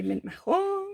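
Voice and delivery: exaggerated mock French, last syllable held high; French accent. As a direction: ham up a French accent and sing out the ending. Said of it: "do a French accent every time".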